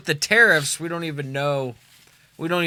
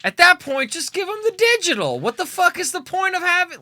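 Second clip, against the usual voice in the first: exasperated rant; 14 semitones higher than usual. Real, not acted. Ranting about physical game carts.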